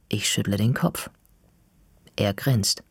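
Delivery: usual speaking voice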